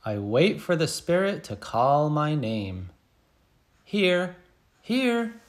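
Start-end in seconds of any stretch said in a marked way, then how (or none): 3.8-4.3 higher, eager "Here"; a kid calling out present; eager roll call. Pretend. 4.8-5.3 much higher, eager "here" called out; a kid raising their hand and calling. Pretend. Answering roll call.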